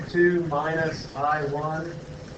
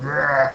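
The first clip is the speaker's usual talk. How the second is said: mock drunken belch, drawn out; imitated belch. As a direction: imitate a big rough burp. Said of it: "lets out a big old belch".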